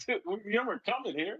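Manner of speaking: laughing tease at the defense; mock teammate taunt.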